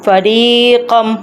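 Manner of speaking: slow, measured tajweed recitation; Quran recitation. Shown as a demonstration. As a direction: recite word by word, drawing out the long vowels. Teacher recites verse word by word. (ٹھہر ٹھہر کر تجوید سے تلاوت)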